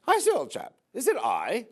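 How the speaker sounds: very high, hammed-up British accent. Judas answering at the Last Supper, comic British accent. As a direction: do a high-pitched caricature of a British chap. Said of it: "with his British accent".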